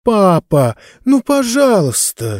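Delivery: put-on girl's high, whining drawn-out begging; plaintive plea. A narrator's reading. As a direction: plead pitifully with papa. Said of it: "жалобно протянула девочка". (жалобно, протяжно)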